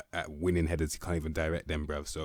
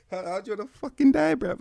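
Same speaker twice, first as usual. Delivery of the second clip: laughing; 9 dB louder than usual. Real, not acted. Laughing at bad player.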